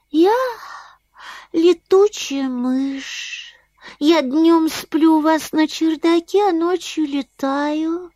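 high, slow little bat; a sleepy bat speaking sweetly; squeaky bat voice. Acted.

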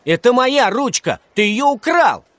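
high, loud schoolboy outrage; indignant accusation. A narrator's reading. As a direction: accuse the thief at full pitch. Boy accuses classmate of theft.

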